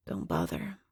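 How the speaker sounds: low, rasping weak voice from the shadows; dry rough woman's voice. Acted. Captive woman warning Scarlet from the shadows, low rasp. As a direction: croak it out of the dark. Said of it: "Her voice is dry, rough like sandpaper".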